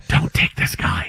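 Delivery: slow, forceful mock whisper; stage whisper. Host playing someone advising a coach against a draft pick, stage whisper. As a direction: whisper it like urgent secret advice, played up. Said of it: "he needed someone to whisper to him".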